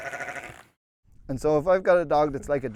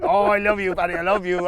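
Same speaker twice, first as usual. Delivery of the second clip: loud, higher fawning dog voice; 4 semitones higher, 5 dB louder than usual. Retold or imitated. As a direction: gush it like a needy dog.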